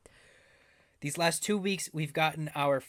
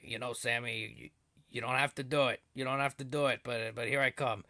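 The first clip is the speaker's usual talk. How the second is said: mafia boss impression. [name] telling Sami he doesn't have to, hushed mock menace. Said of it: "I don't know what that impression was".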